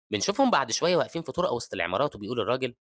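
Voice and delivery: ordinary delivery, nothing marked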